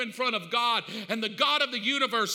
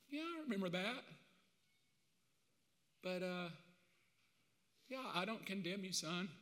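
tender forgiveness; 2 semitones lower, 12 dB quieter than usual. Acted. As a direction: murmur it like a father to his son. God answering Mark gently.

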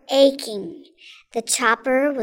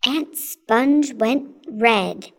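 plain reading voice

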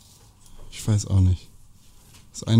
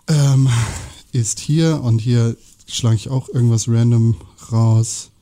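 ordinary talk, nothing marked

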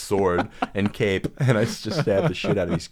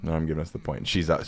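ordinary delivery

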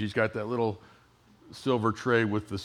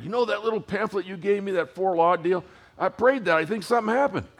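excited friend; 8 semitones higher, 3 dB louder, faster than usual. Retold or imitated. Friend calling with excited news.

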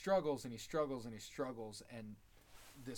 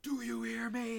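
much higher and louder, desperate demand; Elliot yelling; 12 semitones higher, 7 dB louder than usual. Retold or imitated. Recapping Elliot screaming at his captor, high and loud. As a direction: yell it up high at a silent captor. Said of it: "He's like".

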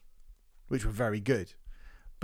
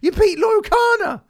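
much higher, loud shouted outrage; furious rant; 7 dB louder than usual. Real, not acted. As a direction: yell your disbelief at the winner. Ranting at the award winner.

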